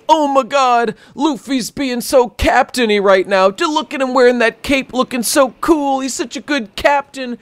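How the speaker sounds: mock the fans swooning over their hero; high-pitched mock fan gushing, dragged out. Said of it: "the whole fucking One Piece reading world".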